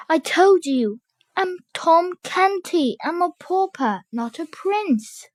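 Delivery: high, fading tearful plea; whimpering plea. Acted. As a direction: plead it near tears, fading.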